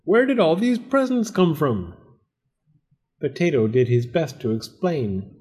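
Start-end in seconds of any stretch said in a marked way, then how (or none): 0.0-1.9 high-pitched amazed question; storybook astonishment, pitched up; astonished cry. A narrator's reading. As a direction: marvel as if just woken. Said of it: "he cried when he awoke".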